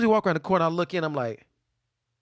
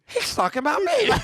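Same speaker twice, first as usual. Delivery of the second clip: Calvin impression; 13 semitones higher than usual. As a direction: squeal it out as Calvin. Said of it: "he might be like".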